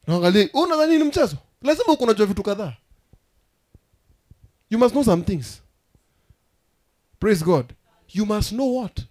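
loud preacher's declamation, pitch lifted; full-force preaching. Acted. Preacher telling church you act when tired, loud preaching. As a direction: drive each phrase out to the congregation.

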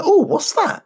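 high, surprised customer asking; curious diner. Acted. Customer voiced in joke.

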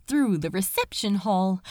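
plain reading voice

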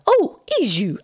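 high, swooping exclamation; flustered realisation. A narrator's reading. Aunt corrected on a name, flustered exclamation. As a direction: exclaim it high as the mistake dawns. Aunt embarrassed at her mistake.

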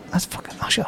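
hushed star-spotting whisper; acting out a secret tip-off under the breath; retold whisper. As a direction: whisper it discreetly to a workmate. Colleague discreetly pointing out a celebrity.

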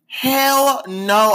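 a sassy, outraged no; emphatic hell no. Real, not acted. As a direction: blurt it out like the very idea is absurd. Emphatic denial of being perfect.

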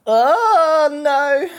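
high, loud yelp of dismay; sudden alarm. Real, not acted. Caught off guard mid-rant, a loud high yelp. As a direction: yelp as something goes wrong.

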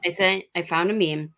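ordinary talk

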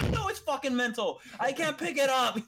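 loud, high retold yell; exasperated protest. Lifter answers those saying it's all mental, exasperated.

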